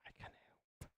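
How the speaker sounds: breathy whisper, no voice. Real, not acted. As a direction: whisper it back cheekily. Whispering back at partner.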